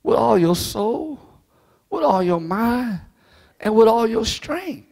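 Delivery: slow, drawn-out scripture recital; slow preacher cadence; preaching cadence. Acted. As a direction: lay each phrase down like a sermon.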